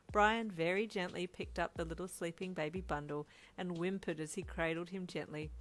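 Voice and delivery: ordinary delivery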